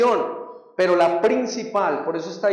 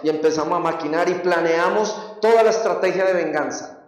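ordinary talk, nothing marked

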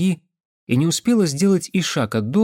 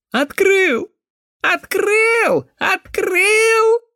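very high cawing shout; crow-cawing cry; 4 dB louder, much slower than usual. Acted. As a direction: cry each word like a crow's caw. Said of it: "закричал парнишка, подражая голосом вороньему карканью". (крик, подражающий вороньему карканью)

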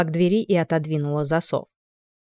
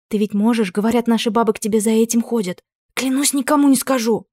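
ordinary delivery

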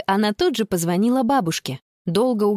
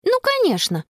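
high-pitched cry of agreement; excited exclamation; 12 semitones higher than usual. Acted. Girl seizes on the train idea, high excited exclamation. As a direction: exclaim it as a sudden great idea. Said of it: "воскликнула Конни".

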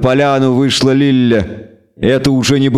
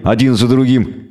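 usual speaking voice

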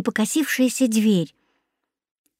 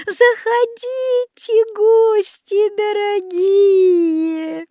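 put-on high, slow singsong old woman; sweet luring; 6 semitones higher, slower than usual. A narrator's reading. Old woman invites hungry children in, sweet luring. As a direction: entice them with long honeyed vowels, rising higher.

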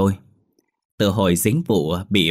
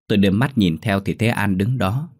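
usual speaking voice

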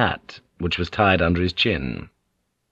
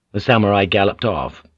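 ordinary delivery, nothing marked

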